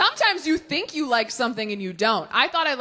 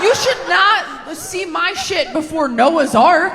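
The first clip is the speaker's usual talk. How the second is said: louder, slow, emphatic through laughter; playful scolding of the audience; laughing outburst; 5 semitones higher, 6 dB louder, slower than usual. Real, not acted. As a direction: stretch it out in loud mock horror. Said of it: "scolding herself laughing".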